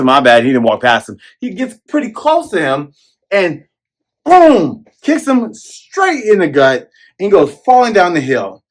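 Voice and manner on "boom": loud boom. Real, not acted. Narrating the kick.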